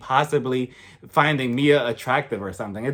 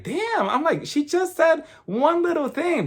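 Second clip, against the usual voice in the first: high-pitched incredulous reaction; mock disbelief; 12 semitones higher, slower than usual. Viewer reacts to Candace being shut down, incredulous. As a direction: protest in a much higher voice, drawn out at the end. Acting out shocked reaction to the shutdown.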